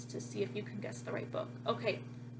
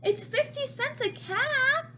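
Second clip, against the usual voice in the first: high, loud singsong street call; peddler calling louder; 9 semitones higher, 5 dB louder than usual. A narrator's reading. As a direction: hawk the caps, a bit desperate. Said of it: "He walked up and down the street calling".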